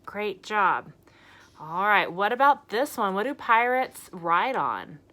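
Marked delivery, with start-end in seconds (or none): none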